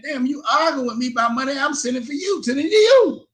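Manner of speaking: retold protest climbing high and loud at the end; exasperated retort. Arguing with ex-wife over money sent to her, exasperated. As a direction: argue back, pitch leaping up on the last words.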